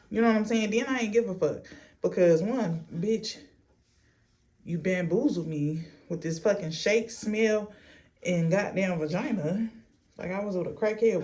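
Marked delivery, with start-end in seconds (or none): none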